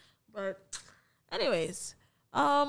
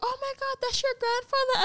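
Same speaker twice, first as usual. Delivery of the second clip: much higher, mock shocked gasp of strangers; mock surprise; 13 semitones higher than usual. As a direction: play the stunned onlooker, pitched way up. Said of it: "And they're like".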